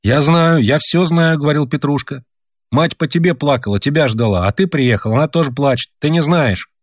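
put-on a sleepy child scolding the grown-ups; boy's voice. A narrator's reading. Petrushka from the stove scolds his parents, lightly boyish.

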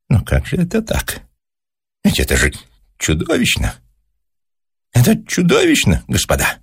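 lightly voiced scientist, high peaks of dismay; a touch of horrified amazement; appalled professor. A narrator's reading. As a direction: protest in mild, high disbelief. Professor appalled, addresses the toads.

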